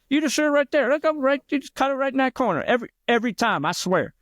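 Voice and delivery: very high, cocky bar guy voice; imitating the bar player. Mocking the bar guy insisting on his dot trick, very high. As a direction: squeak it out like a know-it-all drunk. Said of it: "he talked like that, of course".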